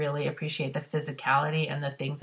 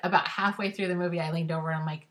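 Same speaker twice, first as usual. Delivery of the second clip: normal talking voice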